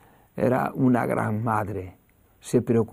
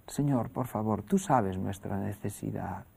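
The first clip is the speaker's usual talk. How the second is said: quiet prayer; 4 semitones lower, 8 dB quieter than usual. Retold or imitated. Madre e hijo oran por zapatos.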